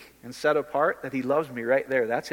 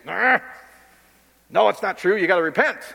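mock church scold; 5 semitones higher, 6 dB louder than usual. Mocking church teaching voice.

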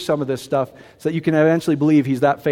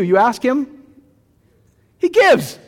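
rising preached climax; 7 semitones higher, 3 dB louder, slower than usual. Real, not acted.

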